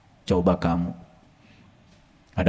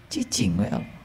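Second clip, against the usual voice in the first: hushed; 12 dB quieter than usual. Acted. Allah just keeps silent.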